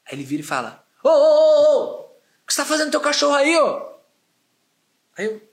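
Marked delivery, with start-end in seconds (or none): 1.0-4.0 high, loud, fast outraged yell; angry stranger confronting a dog owner, loud and high. Retold or imitated. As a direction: call out a stranger for scolding his dog. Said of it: "o cara fica louco".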